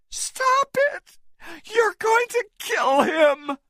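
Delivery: very high, desperate shout. A narrator's reading. Anne grabbing Engelhorn's arm, a very high desperate shout. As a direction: scream at him to stop. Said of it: "she shouted".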